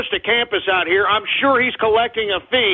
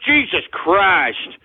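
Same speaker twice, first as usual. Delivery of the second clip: usual speaking voice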